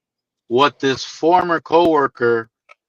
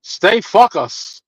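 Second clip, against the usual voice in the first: slow imitated heavy Greek accent; slow heavy Greek accent, deadly serious; 3 semitones higher, much slower than usual. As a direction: mimic a Greek coworker's heavy accent, slowly. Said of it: "in his heavy accent".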